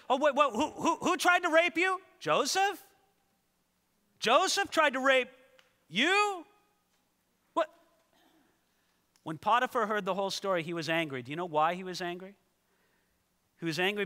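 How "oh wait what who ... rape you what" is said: high, loud, startled questioning; shocked husband. Acted. Potiphar hears his wife's accusation, high startled disbelief. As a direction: stumble over the words in disbelief.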